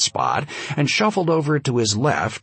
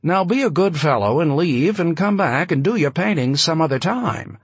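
cool, higher, commanding; frosty commanding; 7 semitones higher than usual. A narrator's reading. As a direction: order him like a cold aristocrat. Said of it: "lion's tone of cool command".

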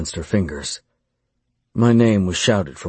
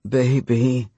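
higher-pitched lover's groan; 5 semitones higher than usual. A narrator's reading. Lover answering his orders, groaning. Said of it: "she groaned".

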